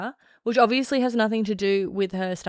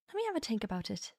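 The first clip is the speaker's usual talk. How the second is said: quick, soft Irish accent; soft practice line in Irish accent; trying an Irish accent; 10 dB quieter, faster than usual. Shown as a demonstration. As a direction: quietly rattle it off in an Irish accent. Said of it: "I would say that my Irish accent".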